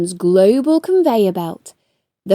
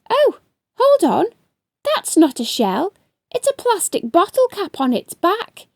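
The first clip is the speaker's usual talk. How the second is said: bright, higher surprised mermaid; Luna's voice; 7 semitones higher than usual. Acted. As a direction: gasp it out, noticing the crab. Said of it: "said Luna".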